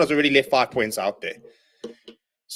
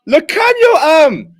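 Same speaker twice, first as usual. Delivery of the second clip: excited yell; 7 dB louder, much slower than usual. Real, not acted. Fan cheering a great offload.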